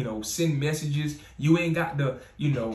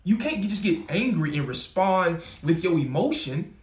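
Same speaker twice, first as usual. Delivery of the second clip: ordinary talk, nothing marked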